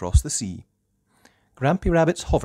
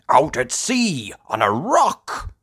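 loud, raised, matter-of-fact answer; Grandad Dog; 8 semitones higher, 6 dB louder than usual. Acted. Grandad Dog answers Peppa, loud matter-of-fact voice. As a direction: state it firmly in character. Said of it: "says Grandad Dog".

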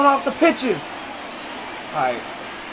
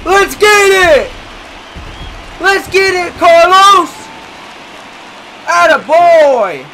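celebratory shout; 4 semitones higher, slower than usual. Real, not acted. Gamer praising Carlos's home run, celebratory shout. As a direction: cheer him round the bases.

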